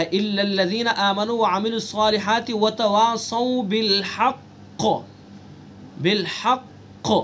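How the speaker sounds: recited verse with a bounced qaf in haqq; strong qalqalah on bil-haqq. Shown as a demonstration. Teacher reciting a verse example, echoing qaf on bil-haqq. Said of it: "سب سے زیادہ قلقلہ ہوگا".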